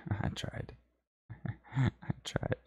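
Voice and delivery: soft, breathless laugh through the words. Real, not acted. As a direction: giggle helplessly at your own failed attempt. Laughing at own failed impression.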